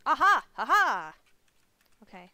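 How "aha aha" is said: loud, triumphant "Aha!"; triumphant shout. Real, not acted. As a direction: shout it out like you've just won. Triumphant outburst while playing.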